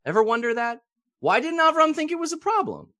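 high, puzzled questioning; rhetorical wonder. Real, not acted. Teacher puzzling aloud to students, high and amazed. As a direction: ask it up high, genuinely baffled.

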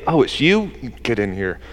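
low, soft, dismissive grumble; unimpressed welcome. Pretend. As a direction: let them in grudgingly, dropping very low and quiet. Unimpressed welcome at heaven's gate, acted.

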